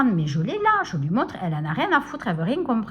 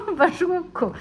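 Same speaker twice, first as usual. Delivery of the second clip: laughing defiance; 8 semitones higher, 4 dB louder than usual. Real, not acted. Angry rant about stubborn clerk.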